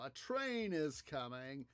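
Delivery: usual speaking voice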